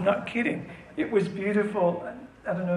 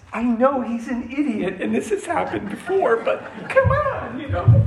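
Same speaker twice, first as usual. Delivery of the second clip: much higher, louder, through laughter; laughing plea; 7 semitones higher, 6 dB louder than usual. Pretend. Jesus laughing while pleading for the speaker to God. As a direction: plead half-laughing, stretching come on.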